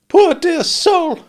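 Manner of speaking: high, slow, loud fussy lady; a shrill-pitched exclaim of false pity; Camilla's abrupt voice. A narrator's reading. Narrator voicing Camilla pitying someone, abrupt and high. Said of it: "with an abruptness of manner quite like my sister's".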